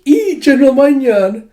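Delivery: very high, loud mock squeal; hammed-up swooning shriek; fangirl squeal. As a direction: squeal the idol's name like a fan. Fangirls squeal at female general.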